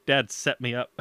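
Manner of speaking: ordinary talk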